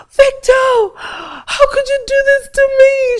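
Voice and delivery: high, loud anguished cry at her husband; screaming in pain. Retold or imitated. Wife catching husband with her best friend, anguished screaming. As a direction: scream it out, betrayed and crying. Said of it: "in so much pain and she was crying and screaming".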